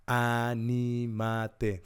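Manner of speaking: very slow, every syllable stretched; slowly urging, syllable by syllable; drawn-out exhortation. Real, not acted. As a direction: stretch the word out to land it. Preacher stretches his exhortation word.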